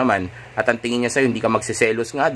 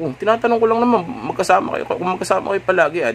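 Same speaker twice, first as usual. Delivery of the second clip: "tinatanong ko lang naman" pitched much higher and fast, sounding rattled; flustered asking; 8 semitones higher, faster than usual. Shown as a demonstration. Boyfriend backpedalling after her outburst, high and rushed. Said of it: "hindi mo na kailangan sabihin yan".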